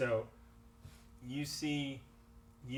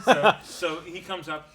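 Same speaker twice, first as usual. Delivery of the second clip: laughing; 4 semitones higher, 12 dB louder, slower than usual. Real, not acted. GM laughing as he starts.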